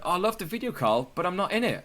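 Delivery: high, plaintive mock complaint; whiny friend. Friend complaining she was left out of the video, high and plaintive. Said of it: "they went".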